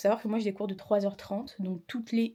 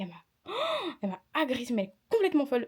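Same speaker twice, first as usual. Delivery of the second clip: gasping, pitch jumping up in disbelief; outraged gasp; 5 semitones higher, faster than usual. Real, not acted. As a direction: gasp then blurt it out, shocked. Outraged at the teacher's attack.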